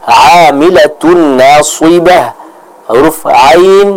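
Amilatun with a throaty ain, slowly; clear ain. Shown as a demonstration. Teacher modelling the ain sound, slow demo. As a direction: stretch Amilatun from the mid throat. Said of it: "sempurnakan pengucapannya".

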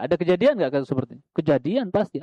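normal talking voice

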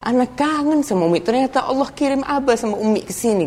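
high-pitched, wistful exclamation to mum and dad; daughter missing her parents. Acted. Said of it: "Sang anak mengatakan".

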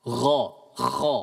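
ghain and kha demonstrated; "Gha, kha" as a throaty voiced gha, then a rasped kha; letter sounds demo. Shown as a demonstration. Teacher demonstrating the two throat letters. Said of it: "Ghain dan kha".

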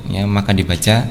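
usual speaking voice

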